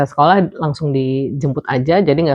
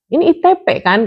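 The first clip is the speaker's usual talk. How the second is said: high, excited know-it-all; eager; 11 semitones higher than usual. Retold or imitated. Daycare staff eagerly recognizing the condition, high excited. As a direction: jump in eagerly, pitched high.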